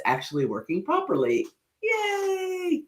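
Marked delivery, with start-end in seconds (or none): 1.8-2.8 high, drawn-out squeal of delight; cheering. Real, not acted. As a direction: squeal a long little cheer. Cheering a viewer's response.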